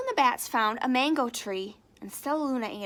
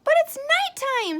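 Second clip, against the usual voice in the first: very high, loud squeak; 8 dB louder than usual. Acted. Stellaluna worries to Mother Bat, high squeak. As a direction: squeak your worry. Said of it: "Stellaluna squeaked".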